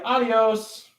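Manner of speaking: high sing-song adios; breezy goodbye. Pretend. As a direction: toss off a cheerful farewell. Dismissing third party.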